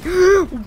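loud, high gasp of surprise; real startled intake of breath; shocked gasp. Real, not acted. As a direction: gasp sharply in disbelief. Startled by a one-shot kill.

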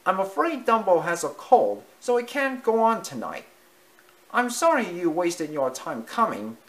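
high, apologetic mouse voice; sorry little mouse. Acted. Timothy voiced in story.

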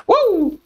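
short high-pitched "uh!"; high whoop. Real, not acted. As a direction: let out a high hoot. Whoops during creeper fight.

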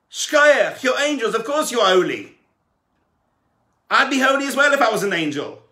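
loud, high, worked-up objection to the angels; indignant protest. Acted. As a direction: argue your case hard, voice up and pushing. Man protesting to heavenly court.

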